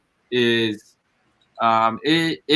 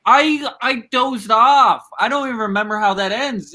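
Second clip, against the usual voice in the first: exaggerated complaint; 8 semitones higher than usual. Real, not acted. Heated movie disagreement.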